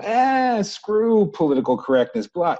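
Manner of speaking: high scoffing Ah, then brushing it off; mock a careless guy waving it away; dismissive scoff. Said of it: "it's easy to go".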